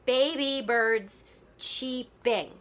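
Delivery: slow, syllables set apart; counting out syllables. Shown as a demonstration. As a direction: tap out each syllable slowly. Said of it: "let's count the syllables".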